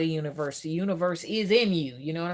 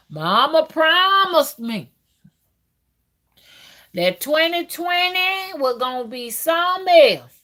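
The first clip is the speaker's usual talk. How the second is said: excited build-up; 6 semitones higher, 6 dB louder than usual. Real, not acted. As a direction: hype up mama's big promise. Excited about mama's promise.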